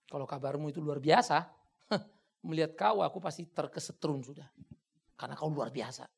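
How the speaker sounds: normal talking voice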